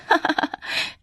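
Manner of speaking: high, airy mocking laugh; acted derisive giggle; scornful laugh. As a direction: laugh off the very idea.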